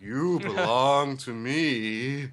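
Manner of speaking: very slow, hammy villain lines; overplayed Griffith. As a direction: drag every word out like a ham actor. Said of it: "overplaying the character on every line".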